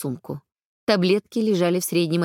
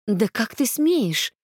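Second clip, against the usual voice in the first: slow, higher furious outcry; furious exclamation; 5 semitones higher, slower than usual. A narrator's reading. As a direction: spit it out in outrage. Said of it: "яростно воскликнула я".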